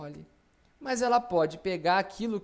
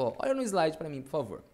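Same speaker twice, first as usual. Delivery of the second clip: normal talking voice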